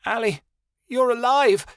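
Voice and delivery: high, ringing cry of joy; overjoyed shout of relief; joyful cry. A narrator's reading. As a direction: cry out her name in disbelief. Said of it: "she cried".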